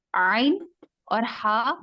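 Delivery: عین and ہا slowed, from the mid throat; careful articulation. Shown as a demonstration. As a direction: say عین and ہا slowly from the middle of the throat. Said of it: "وسط الحلق سے ادا ہو".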